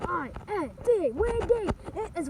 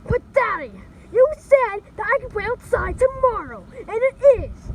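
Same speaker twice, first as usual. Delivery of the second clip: put-on high, loud childish protest; 6 semitones higher, 10 dB louder than usual. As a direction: argue back loudly at dad. Child protests to his father.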